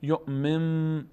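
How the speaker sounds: یؤمن said yu'mimm, slow with long final m; n turned to m. Shown as a demonstration. Teacher shows the change, یؤمن slow with m for n. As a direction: hum an m at the end of یؤمن.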